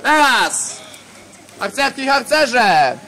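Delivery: drill sergeant. Real, not acted. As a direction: bark the order to the ranks. Leader commands assembled scouts.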